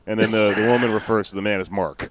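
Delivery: ordinary delivery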